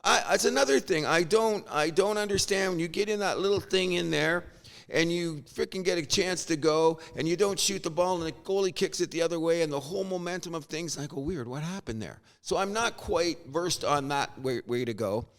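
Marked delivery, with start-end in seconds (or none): none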